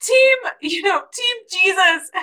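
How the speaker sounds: high, playful team chant; root for the team with a laugh; high-pitched mock sideline cheer; Jesus cheering. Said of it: "Jesus being like".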